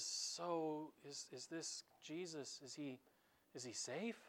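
quietly unsure, half afraid to ask; nervous question. Acted. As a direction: beaver asking if the lion is safe, hushed. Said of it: "and says".

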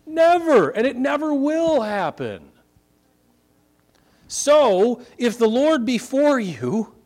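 NEVER rings out high and loud; emphatic shout. Real, not acted. Pastor to congregation, loud emphatic insistence. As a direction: hammer home that it never happens.

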